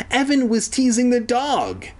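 high-pitched tattling whine; whiny cry. A narrator's reading. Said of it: "he cried, like a little bitch".

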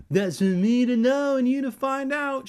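very high, loud sing-out taunt; sneering taunt. A narrator's reading. Andy refuses Evan a second time, squealing a taunt. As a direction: repeat it as a squealed childish tease. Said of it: "she repeated like a repeatard".

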